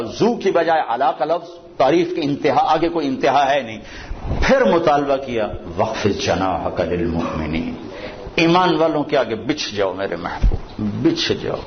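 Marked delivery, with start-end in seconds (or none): 5.8-7.9 low, measured recitation of the verse; quranic recitation. Acted. Preacher recites the verse to the congregation, low and measured.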